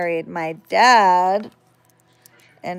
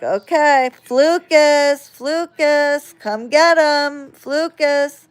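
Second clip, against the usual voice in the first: high, loud, slow summoning call; 7 semitones higher, 5 dB louder, slower than usual. Retold or imitated. Babysitter summoning the made-up monster, loud high call.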